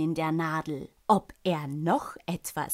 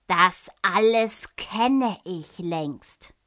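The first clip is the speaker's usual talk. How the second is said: slow, louder bored complaint; bored king; 5 dB louder, slower than usual. A narrator's reading. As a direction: drag it out, royally unimpressed. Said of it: "der König gähnte nur".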